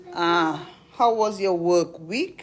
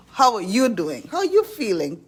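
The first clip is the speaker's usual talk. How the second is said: ordinary talk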